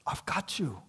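a soft, breathy whisper of comfort; tender whisper. Retold or imitated. Jesus reassures his scared disciples in a tender whisper.

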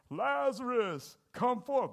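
high and loud, slow Lazarus drawn out; booming command. Acted. Jesus calling Lazarus out of the grave, booming. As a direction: call the dead man out of the tomb.

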